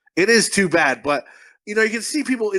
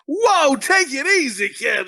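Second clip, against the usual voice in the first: mock alarm, higher and drawn out; taken aback; 6 semitones higher, slower than usual. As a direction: act out a slow "calm down" reaction. Acting out Bone's startled reaction.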